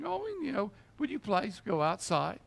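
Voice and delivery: sweetly and timidly, mocking the mild reading; mock polite request. Mocking a timid Jesus asking mourners out, dainty. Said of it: "That's not what Jesus did".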